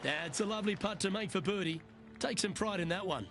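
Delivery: normal talking voice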